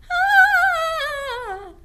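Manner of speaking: very high, loud scream; creepy distant scream. Shown as a demonstration. Teacher shows the class a spooky scream. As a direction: scream like someone lost in a dark forest. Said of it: "we hear a creepy scream like this".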